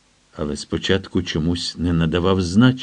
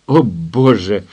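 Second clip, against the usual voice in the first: ordinary delivery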